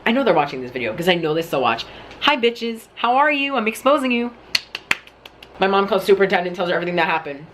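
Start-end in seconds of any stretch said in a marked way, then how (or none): 2.2-4.3 high-pitched, mock-sweet hello; taunting greeting. Real, not acted. To old bullies watching the video, high taunting greeting. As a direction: greet them sweetly to needle them.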